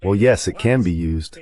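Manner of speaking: ordinary delivery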